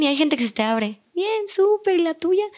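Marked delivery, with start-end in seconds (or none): 1.1-2.4 much higher, chirpy voiced customer; cheerful reply. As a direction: answer back all bright and lifted. Customer answering the cheerful greeting.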